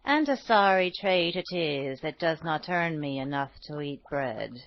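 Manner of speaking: put-on low, soft complaining old woman; poor old woman. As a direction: sigh out a poor woman's lament.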